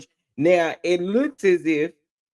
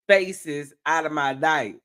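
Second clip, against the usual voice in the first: ordinary delivery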